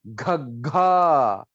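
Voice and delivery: घग्घा slow, with forceful chest push; chest pressure. Shown as a demonstration. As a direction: let घग्घा burst out slowly from inside. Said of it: "chest में हमें वो pressure लगेगा". (घग्घा को अंदर से ज़ोर से, धीरे बोलो)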